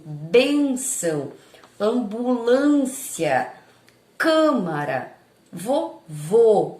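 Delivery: bênção, ambulância, câmara, vovô with the stressed Ê, Â, Â, Ô closed, drawn out and loud; closed stressed vowels. Shown as a demonstration. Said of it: "Quando eu falo assim".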